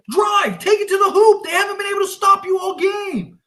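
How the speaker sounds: high, loud urgent coaching shout; yelling instructions. Real, not acted. Fan shouting plays to the Lakers. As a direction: shout the play at the screen.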